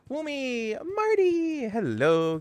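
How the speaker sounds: high, bright call-out to a viewer; excited greeting. Real, not acted. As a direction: squeal their name in delight.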